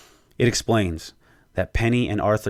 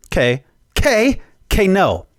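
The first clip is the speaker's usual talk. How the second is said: mock disbelief; 11 semitones higher, 6 dB louder than usual. Real, not acted. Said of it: "reacting to the twist".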